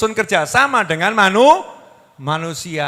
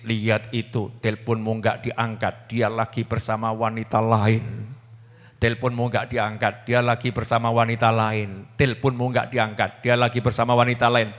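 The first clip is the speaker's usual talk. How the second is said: devil whispering; 8 semitones lower, 4 dB quieter than usual. Acted. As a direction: egg her on softly, like Satan. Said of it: "Tambah setan yang bisikin".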